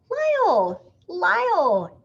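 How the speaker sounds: high, loud calling out; anxious calling through the house. A narrator's reading. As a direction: call for Lyle across the house. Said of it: "called Missus Prim".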